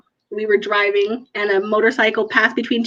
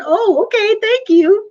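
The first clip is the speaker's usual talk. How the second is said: high, lilting surprised thanks; higher, slightly louder, thrown off balance; taken aback; 10 semitones higher, 4 dB louder, slower than usual. Retold or imitated. Mom to her swearing little son at dinner, taken aback.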